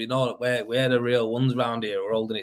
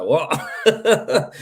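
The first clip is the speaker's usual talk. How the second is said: mock surprise; 6 semitones higher, 6 dB louder than usual. Retelling a customer's surprise.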